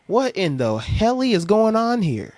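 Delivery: slow incredulous outrage; exasperated. Real, not acted. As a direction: drag out disbelief. Exasperated reaction.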